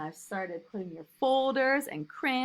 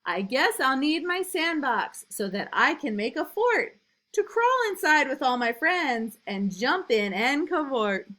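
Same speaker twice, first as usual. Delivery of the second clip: lively, louder storybook reading; reading to children; 5 semitones higher, 6 dB louder than usual. A narrator's reading. Teacher reading a picture book to new kindergartners, lively and loud. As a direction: read it big and bouncy for little kids.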